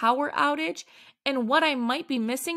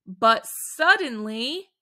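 plain reading voice

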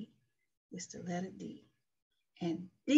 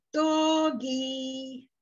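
doggy stretched slow and higher; drawn-out teaching word; 4 semitones higher, 4 dB louder than usual. Shown as a demonstration. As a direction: draw out DOGGY for a child. Teacher presents a word to child.

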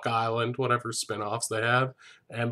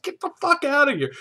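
very high, loud kick-out; mock angry dismissal; 6 dB louder than usual. Host plays Claire ejecting the man, mock angry and high-pitched. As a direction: throw them out in a hammed-up screech. Acts Claire throwing him out.

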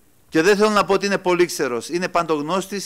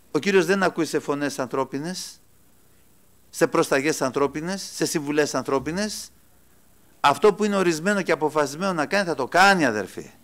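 normal talking voice

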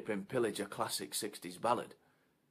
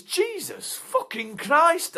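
much higher, loud and slow; exasperated outburst; 14 dB louder, much slower than usual. Acted. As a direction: drag it out in disbelief. Outraged exclamation.

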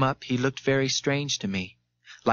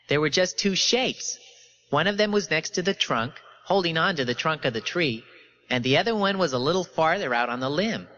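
falsely confident witness; 4 semitones higher than usual. A narrator's reading. Lepper's testimony in character voice.

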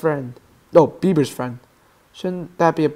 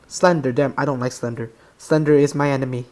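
ordinary delivery

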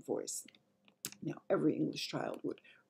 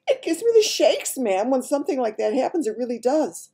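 high, loud, overwrought maid; shaken maid; 9 semitones higher, 10 dB louder than usual. Acted. Maid confessing the shakes to the Queen, shaken. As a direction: blurt out the fright.

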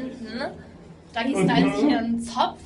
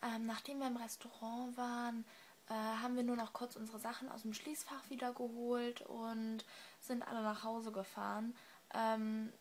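hushed late-night talk, much softer; quiet voice; 18 dB quieter than usual. Real, not acted. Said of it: "ich muss leise sein".

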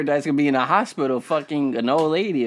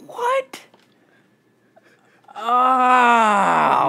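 shocked; 7 semitones higher than usual. Real, not acted. Shocked reaction to holes.

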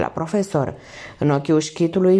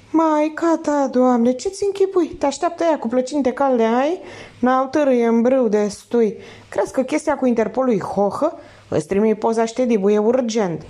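higher, cocky slangy crook; a lightly voiced wisecracking thief; streetwise thief; 7 semitones higher than usual. Thief scolding the professor.

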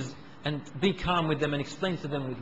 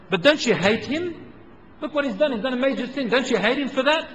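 high, incredulous protest; indignant crowd; 7 semitones higher, 4 dB louder than usual. Retold or imitated. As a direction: push back in disbelief. Crowd protesting to Abu Darda.

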